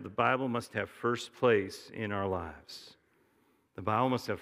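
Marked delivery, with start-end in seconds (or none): 0.0-2.9 slow and deliberate, word by word; slow dictation. Shown as a demonstration. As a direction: spell it out so they can write it down. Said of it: "Here's something you write down".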